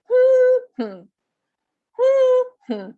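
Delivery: HOO-hmm, first beat punched high; exaggerated stress hum. Shown as a demonstration. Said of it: "that's a little exaggerated".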